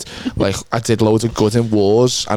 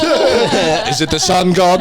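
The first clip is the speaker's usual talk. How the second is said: laughing; 6 semitones higher, much slower than usual. Real, not acted. Joking while laughing.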